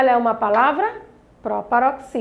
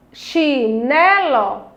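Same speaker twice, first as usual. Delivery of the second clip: "Chinelo" very slow, chi-NE-lo; separated syllables; much slower than usual. Shown as a demonstration. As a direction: stretch each syllable of "Chinelo". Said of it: "Vamos separar". ("Chinelo" bem devagar, sílaba por sílaba)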